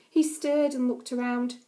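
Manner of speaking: ordinary delivery, nothing marked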